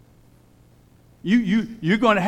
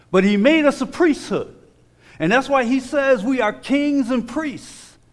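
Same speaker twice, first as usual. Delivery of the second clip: higher, louder preaching climb; rising preacher fervor; 9 semitones higher, 3 dB louder than usual. Real, not acted. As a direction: lift it up like a revival. Preacher building to a peak.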